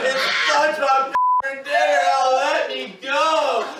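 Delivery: high, loud, laughing through it; pinned brother shouting what he's made to say; shouted forced confession. Retold or imitated. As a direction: yell the humiliating lines to get free. Forced humiliating chant.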